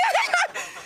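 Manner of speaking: shrieking giggle; high squealing laugh. Real, not acted. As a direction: let out a shrill laugh. Squeal laugh after joke.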